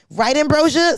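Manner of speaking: high, loud call for backup. Real, not acted. Calling on her friend to back up the story, high and loud. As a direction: call her name to get her on your side.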